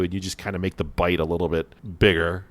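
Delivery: ordinary talk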